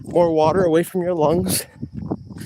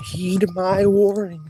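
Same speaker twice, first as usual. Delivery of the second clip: slow repeated warning; 5 semitones higher, slower than usual. Pretend. Repeats warning slower, drawn out.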